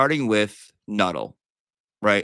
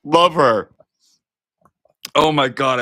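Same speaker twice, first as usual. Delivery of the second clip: gushing; 4 semitones higher, 8 dB louder, slower than usual. Real, not acted. Gushing over a juror.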